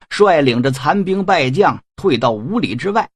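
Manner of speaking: ordinary delivery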